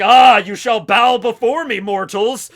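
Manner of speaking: booming self-declared god; megalomaniac rant. Acted. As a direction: proclaim loudly to mortals. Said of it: "Eneru's there and he's like".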